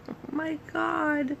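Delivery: a moved, high, slow gasp of words; touched surprise. Retold or imitated. Recalling getting flowers from friends, high and slow. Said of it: "I was like".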